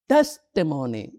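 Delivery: "testimony" with a loud stressed TES; front-stressed "testimony"; first-syllable stress. Shown as a demonstration. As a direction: punch the opening TES of "testimony". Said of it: "emphasis is in the first syllable".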